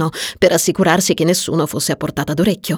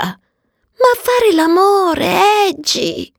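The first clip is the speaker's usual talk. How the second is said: high, drawn-out romantic swoon; slow, high-pitched pleading to a friend; yearning plea; 12 semitones higher, much slower than usual. Acted. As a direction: stretch it out high and longingly.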